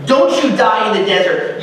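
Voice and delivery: usual speaking voice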